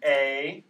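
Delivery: a loud clear A; model vowel again. Shown as a demonstration. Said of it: "Let's try it again".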